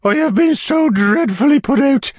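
loud, very high falsetto complaint; distressed lady. A narrator's reading. Widow complains to the beadle, high distressed voice. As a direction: play an overwrought lady, pitched right up.

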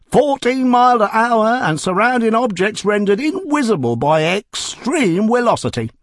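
high, loud bragging pitch; boasting cab driver. Acted. Cab driver boasting of his horse's speed, loud and high. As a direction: brag like a street hawker.

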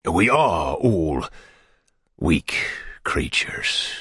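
very slow, low, sinking to a whisper; amorous beadle. A narrator's reading. Beadle with his arm round the matron, slow intimate murmur. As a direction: murmur it intimately, long pause midway.

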